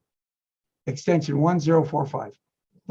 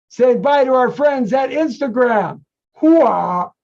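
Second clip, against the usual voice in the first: loud gung-ho soldier; 7 semitones higher, 6 dB louder, slower than usual. Real, not acted. Gung-ho sign-off cheer.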